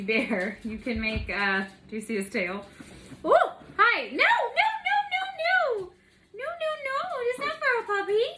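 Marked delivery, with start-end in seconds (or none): 3.2-8.2 very high pet-talk voice; talking to puppy. Real, not acted. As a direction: coo high no-no's at a puppy. Stops her puppy eating raw bacon.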